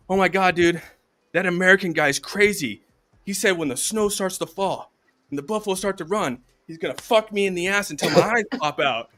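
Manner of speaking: high, loud, agitated storytelling; mock alarmed report. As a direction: play a freaked-out scout reporting to the chief. Indian's alarmed report to chief, joke.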